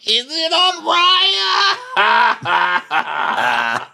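high, loud teasing shout; mocking host teasing a guest about dating apps. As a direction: yell the question like a gleeful host. Mock host shouting question.

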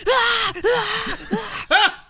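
repeated high, loud "Ah!"; loud motor-mimicking yells. Leaf blower and lawnmower answering the bartender, loud yells. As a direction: yell like a roaring leaf blower. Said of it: "'Cause they're so loud".

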